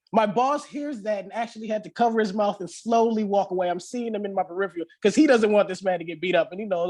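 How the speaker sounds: usual speaking voice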